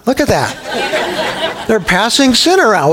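amused, laughing through the words; real chuckle at the congregation. Real, not acted. Preacher laughing at the congregation passing sin around, amused.

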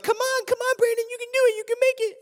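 high mock cheering voice; imitated urging friends. Friends cheering him toward the train door, high-pitched mock urging.

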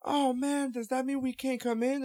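high, whiny mock disappointment; imitating dismayed kids. Acting out dismayed underage kids.

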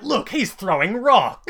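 high, loud, slow jeering vampire; mock the rock-thrower as a vampire. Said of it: "The vampire's like".

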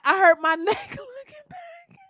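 high, strained fake-hurt voice with a laugh; mock injured plaintiff. Faking an injury in court, high whiny mock pain. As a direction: fake a pained whimper for the court. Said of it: "I'm gonna be like".

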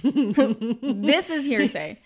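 very slow, laughing disbelief. Real, not acted. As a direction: draw it out in a laugh. Laughing exasperation.

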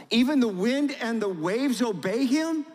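high, loud amazed exclamation; astonished. Acted. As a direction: marvel in disbelief at the calm. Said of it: "they said".

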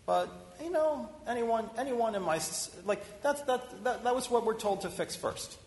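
high mock voice of a mechanic covering himself; mock mechanic excuse. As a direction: play the sheepish repairman passing the blame.

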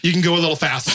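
high, laughing agent voice. Retold or imitated. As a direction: laugh through the agent's dry order.

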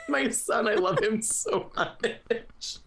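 high, tearful fawning that slows down; hammed-up weepy adoration; mock tearful. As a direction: gush through pretend tears. Playful grief over favourite troll.

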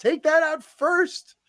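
high, drawn-out exasperated outburst; mock exasperated yell. Fan urging Lana to pull the worst shard, exasperated.

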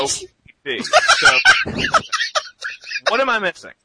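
high laughing yell; laughing shout. Real, not acted. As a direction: break into a loud laugh. Laughs, shouts during sneeze.

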